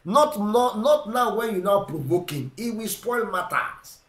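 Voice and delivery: ordinary talk, nothing marked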